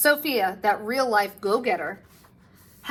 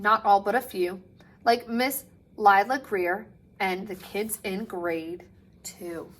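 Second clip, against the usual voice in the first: plain reading voice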